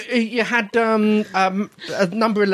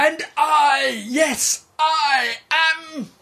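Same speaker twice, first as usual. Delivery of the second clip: dramatic shout; 8 semitones higher, much slower than usual. Acted. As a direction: announce yourself grandly. Dramatic self-introduction.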